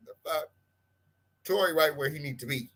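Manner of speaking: normal talking voice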